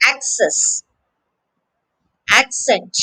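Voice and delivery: access and accent, both stressed on AC; careful "access, accent" with the opening syllable stressed; stress on first syllable. Shown as a demonstration. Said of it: "the stress is on the first syllable".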